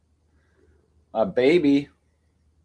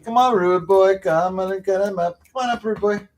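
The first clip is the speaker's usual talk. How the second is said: high coaxing call; 7 semitones higher, 5 dB louder than usual. Real, not acted. Calling cat Rudy.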